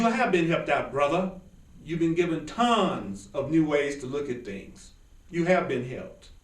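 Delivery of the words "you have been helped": ordinary talk, nothing marked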